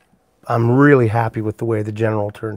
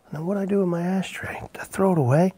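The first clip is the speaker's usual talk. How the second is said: whispering to himself; 7 semitones higher, 7 dB quieter, faster than usual. Real, not acted. Muttering to himself while searching.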